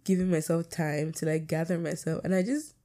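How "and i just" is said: normal talking voice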